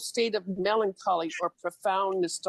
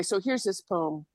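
ordinary talk

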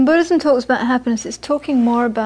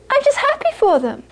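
innocent monk; 13 semitones higher than usual. Retold or imitated. Geshe's innocent answer.

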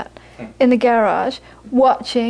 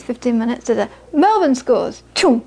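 ordinary talk, nothing marked

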